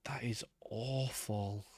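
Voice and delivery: breathy whisper, slowed; hushed horrified whisper. Real, not acted. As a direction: whisper it in disbelief. Whispered reaction to ugly terrain.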